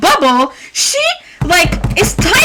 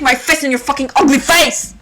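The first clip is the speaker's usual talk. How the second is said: usual speaking voice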